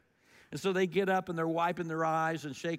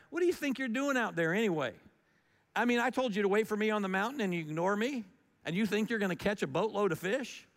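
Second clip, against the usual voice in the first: fast, higher, exasperated rebuke; exasperated scolding; 5 semitones higher, faster than usual. Retold or imitated. Jesus scolds disciples who ignored him, fast and exasperated. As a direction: scold them like a let-down friend. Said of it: "thought behind the tone of it".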